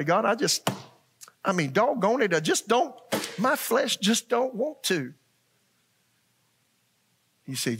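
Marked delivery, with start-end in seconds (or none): 0.0-5.1 high, strained pleading; pleading with God. Retold or imitated. Wrestling with God in prayer.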